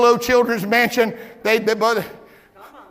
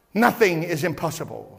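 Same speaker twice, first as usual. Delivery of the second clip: ordinary talk, nothing marked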